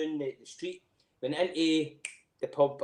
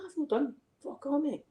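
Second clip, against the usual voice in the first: indignant protest; 12 semitones higher, 3 dB quieter than usual. Retold or imitated. Protesting to the bouncer.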